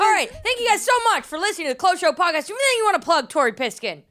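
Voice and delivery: bright, fast announcer sign-off; podcast host outro. Acted. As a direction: wrap up like a host.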